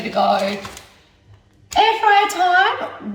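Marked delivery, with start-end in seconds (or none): 1.8-3.1 high, loud, slow announcement; drawn-out cheerful high call; excited announcement. Real, not acted. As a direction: announce it like a show segment. Announcing next step.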